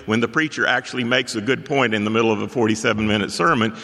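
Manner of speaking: usual speaking voice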